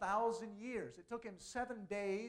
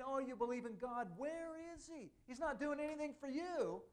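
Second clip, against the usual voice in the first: higher, sneering taunt of the enemies; mock taunting; 8 semitones higher than usual. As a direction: taunt him like a mocking enemy. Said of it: "They're taunting him".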